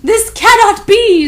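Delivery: high, loud, slow gasp; crisp hammed-up shock; mock crisp disbelief. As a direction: deny it like a stunned villain. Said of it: "in a crisp voice".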